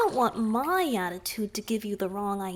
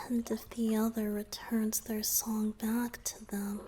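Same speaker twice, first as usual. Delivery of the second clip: hushed whisper; 5 dB quieter than usual. Acted. Merfolk explains courting songs to listener in hushed whisper. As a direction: whisper it like a shy secret.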